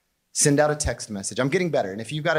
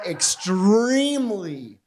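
EXTREMELY drawn out long; emphatic; 3 semitones higher, 3 dB louder, much slower than usual. Real, not acted. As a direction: stretch out the word extremely. Emphasis on how good women are.

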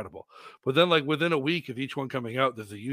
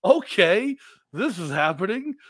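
surprised; 6 semitones higher, 5 dB louder, slower than usual. Retold or imitated. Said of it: "I'm like".

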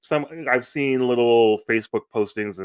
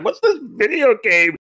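high, slow mocking disbelief; scoffing; 11 semitones higher, 4 dB louder, slower than usual. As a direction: scoff at it, drawn out. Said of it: "I'm like".

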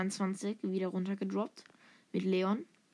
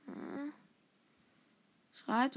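ordinary delivery

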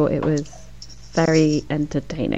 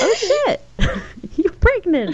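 very high, squeaky mock surprise; mock shocked realization. Recapper voices a husband realizing the pregnancy, mock shocked. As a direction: act out a sudden penny-drop, pitched way up.

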